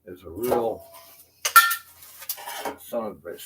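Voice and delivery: fed-up yell that fades; frustrated outburst. Real, not acted. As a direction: let the frustration explode, then ease off. Venting frustration about the house.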